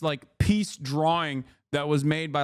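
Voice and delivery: usual speaking voice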